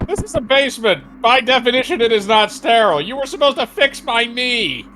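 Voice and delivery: high, forceful, exasperated rant; indignant protest. Acted. Patient objects to surgery in a basement, indignant. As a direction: argue back high and insistent.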